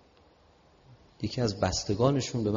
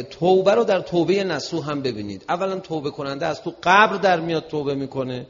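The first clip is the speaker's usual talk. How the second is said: loud, emphatic denunciation; raised-voice rant from the pulpit; indignant preaching; 6 semitones higher, 9 dB louder than usual. Real, not acted. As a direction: denounce it forcefully to the audience. Indignant preaching against films.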